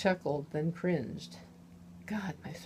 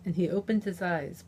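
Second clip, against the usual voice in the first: ordinary delivery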